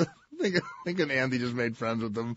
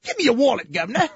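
put-on high, loud English tough-kid parody; wussy English accent; 10 semitones higher, 5 dB louder than usual. Tough English kid demanding a wallet, wussy English accent. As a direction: mug someone in a feeble English accent. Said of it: "They all have that same accent".

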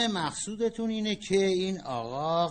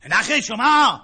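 higher, much louder retort; angry shout; 8 semitones higher, 12 dB louder than usual. Acted. Husband shouting back in a quarrel with his wife. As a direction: shout her down.

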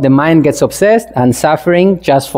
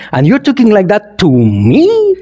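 indignant ego. Acted. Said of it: "I consider you to be lower than me".

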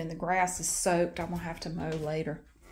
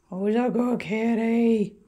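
slow, louder, lilting praise; drawn-out praise for the cat; pet praise; 2 semitones higher, 7 dB louder, slower than usual. Real, not acted. Praising the cat, slow loud pet talk.

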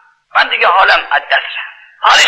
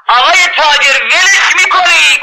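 high, slow questioning call; 10 semitones higher, slower than usual. Retold or imitated. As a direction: ask the merchant pointedly, drawn out. Preacher voices questioner in story.

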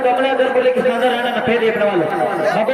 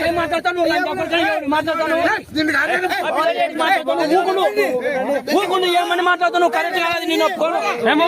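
higher-pitched, indignant challenge; angry confrontation; 5 semitones higher than usual. Real, not acted. Man challenging someone over an insult, angry. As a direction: argue back heatedly, pitch raised.

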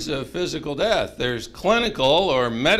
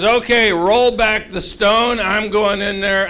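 loud, raised acted order; firm command; 4 semitones higher, 7 dB louder than usual. Jesus ordering the stone rolled back, loudly commanding. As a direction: announce it with take-charge force.

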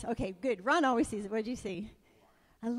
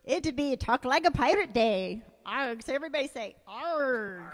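pirate voice; 5 dB quieter, faster than usual. Pretend. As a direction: growl it out like a pirate. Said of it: "So that was my best attempt".

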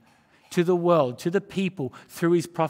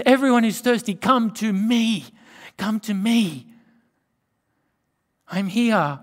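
higher and louder, slowed invitation; loud call of Jesus; 5 semitones higher, 6 dB louder than usual. Acted. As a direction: call the thirsty crowd to come. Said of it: "he says".

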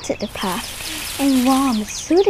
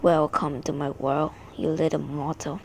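low, softer villain voice; deep teasing villain; 6 semitones lower, 4 dB quieter than usual. A narrator's reading. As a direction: drop low and taunt the mortal. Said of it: "A deep voice came from behind me, teasing".

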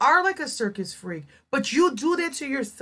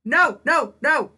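loud, raised cries of refusal; appalled shout; 4 semitones higher, 5 dB louder, slower than usual. Real, not acted. Viewer recoils at the vlogger's video, appalled shouting. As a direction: shout it in horror, punching each word.

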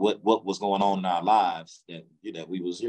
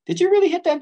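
high, loud, incredulous questioning voice; nosy interviewer; 8 dB louder, faster than usual. Retold or imitated. Company interviewer asking about the girl, high incredulous voice.

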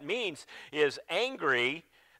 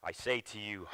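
ordinary talk

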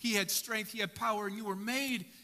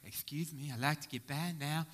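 Garth impression; 6 semitones lower than usual. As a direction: mumble it like shy Garth from Wayne's World. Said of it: "Like Garth".

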